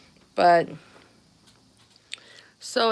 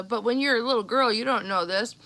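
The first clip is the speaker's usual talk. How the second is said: ordinary talk, nothing marked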